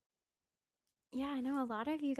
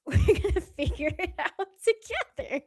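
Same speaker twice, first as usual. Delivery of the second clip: laughing through the words; giggling while talking; 9 semitones higher, 12 dB louder than usual. Real, not acted.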